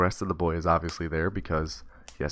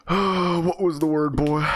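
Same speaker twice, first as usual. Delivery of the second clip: higher and louder, demanding judge; booming judge; 8 semitones higher, 6 dB louder, slower than usual. Acted. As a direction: lean in and demand it from the bench.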